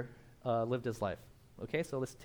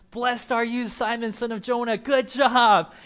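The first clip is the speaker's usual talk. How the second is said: high, loud exuberant praise; excited praise; 7 semitones higher, 9 dB louder, slower than usual. Acted. Jesus praising Peter, high and loud. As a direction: cheer him on.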